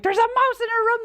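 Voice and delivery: high-pitched mock holler; retold alarmed yell. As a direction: pass on the alarm through the house. Retold shouting about the mouse.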